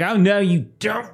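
high-pitched put-on cry of defiance; acted high protest; gunman's mock defiance. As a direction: pitch it up like a startled villain.

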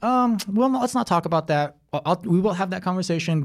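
a dad caught off guard, stalling; flustered dad. Retold or imitated. Dad dodging daughter's question.

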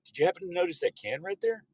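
feigned offhand curiosity; mock-casual question. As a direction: ask it lightly, as if it just occurred to you. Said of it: "and then I go".

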